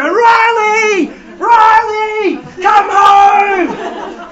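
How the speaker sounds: long, high, full-volume shouts for a lost dog; calling out. Retold or imitated. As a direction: bellow the name as if across an oval in the rain.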